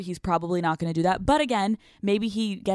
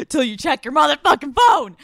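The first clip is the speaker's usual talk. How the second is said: yelled punchline, high and loud; comic yelled ending; shout; 7 semitones higher, 7 dB louder than usual. Real, not acted. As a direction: bark the last words out. Advice rant peaks.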